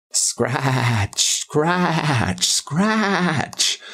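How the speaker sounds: slow, drawn-out spooky scratch words; eerie scratching. A narrator's reading. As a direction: stretch each scratch out like a creepy noise in the dark. Scratching returns at night.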